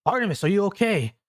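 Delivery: high, fast worried call; worried Hay. Acted. Hay stumbling from the fog calling Artemis, high and worried. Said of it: "She goes".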